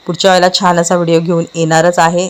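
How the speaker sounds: usual speaking voice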